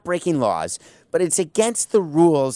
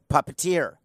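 ordinary delivery

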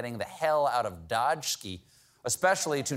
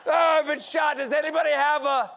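wounded soldier shouting much higher and louder; panicked shout; 12 semitones higher, 6 dB louder than usual. Acted. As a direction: scream for help after being shot.

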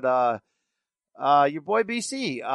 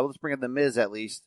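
ordinary talk

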